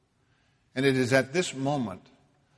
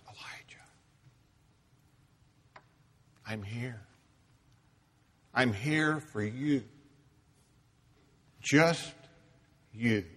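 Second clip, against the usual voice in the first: tender reassurance; 3 dB quieter than usual. Acted. God reassuring the frightened prophet, hushed and tender. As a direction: hold him close with your voice.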